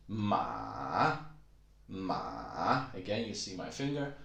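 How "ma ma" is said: "ma" dipping low, then rising; long falling-rising Mandarin "ma"; third tone. Shown as a demonstration. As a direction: drop "ma" into a valley and bring it back up. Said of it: "goes down into the valley".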